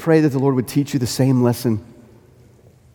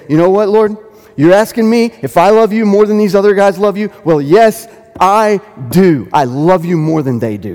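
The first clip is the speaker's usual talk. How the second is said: louder, higher, confident claim of greater love; bold affirmation; 5 semitones higher, 6 dB louder than usual. Pretend. Peter boldly claiming to Jesus he loves more, confident. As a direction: declare it boldly, eye to eye. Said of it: "look Jesus in the eye anymore and say".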